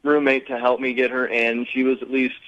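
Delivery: ordinary talk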